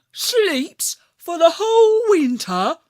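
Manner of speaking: high, loud incredulous rise on whole; can't believe it; astonished question. A narrator's reading. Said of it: "I said".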